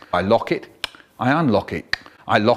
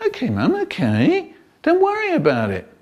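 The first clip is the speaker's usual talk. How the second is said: put-on placating kids; 10 semitones higher than usual.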